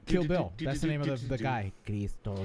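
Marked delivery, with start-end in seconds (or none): 1.8-2.5 Spanish accent. Shown as a demonstration. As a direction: put on a careful Spanish accent. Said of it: "try to do an accent".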